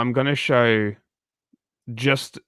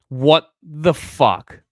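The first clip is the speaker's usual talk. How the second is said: shocked disbelief; 5 semitones higher, 4 dB louder, much slower than usual. Real, not acted. Shocked reacting to shooting video.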